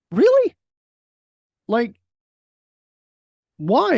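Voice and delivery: sharp high disbelieving really; wife caught off guard, asking why; surprised really. Retold or imitated. Wife questioning husband's answer, high surprised disbelief.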